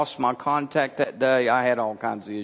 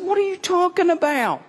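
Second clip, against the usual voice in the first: baffled disbelief. Retold or imitated. Retold shock at grandmother's belief.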